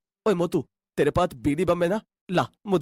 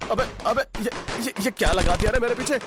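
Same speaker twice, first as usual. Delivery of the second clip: panicked shouting; 3 semitones higher than usual. Acted. Lala Ram yelling as crackers burst behind him, panicked shouting. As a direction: cry for help as firecrackers go off. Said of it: "लाला राम को चिल्लाते हुए".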